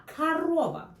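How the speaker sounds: корова said karOva, the first O as A and the second O stressed; корова with the stress on the middle O; Russian корова. Shown as a demonstration. Teacher models the stressed O for learners. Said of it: "first O, sounds like A".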